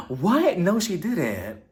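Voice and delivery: hammed-up gossipy astonishment; mock shock. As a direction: imitate someone shocked by gossip. Retold shocked reaction to gossip.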